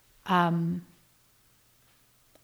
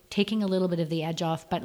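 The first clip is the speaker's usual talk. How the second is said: ordinary talk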